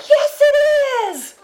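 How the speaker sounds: very high, loud mock outburst; indignant kid shout. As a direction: yell it like a defiant twelve-year-old. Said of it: "And I was like".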